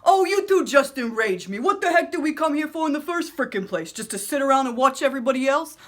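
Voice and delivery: enraged inner voice. Acted. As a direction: explode at the other two voices. Frustrated inner voice ranting.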